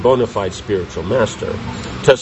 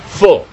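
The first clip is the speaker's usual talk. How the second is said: Full said higher and louder; emphatic answer; 6 semitones higher, 6 dB louder than usual. Shown as a demonstration. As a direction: call out Full firmly. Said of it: "Which word?".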